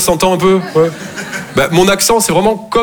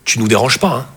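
mother's voice; 6 semitones lower than usual. Retold or imitated. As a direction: gently shoo the kid away, lower. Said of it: "Et elle est là".

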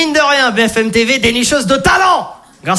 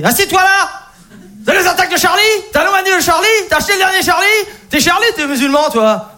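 higher, shouting questions; angry barber; 7 semitones higher than usual. Retold or imitated. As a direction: grill the customer in a rage. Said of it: "le mec était énervé". (coiffeur énervé qui crie)

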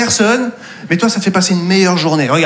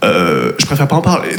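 mysterious low mutter; 10 semitones lower than usual. Pretend. Mock-serious reply.

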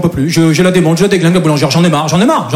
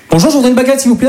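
mock polite order; 6 semitones higher, faster than usual. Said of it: "j'y retourne".

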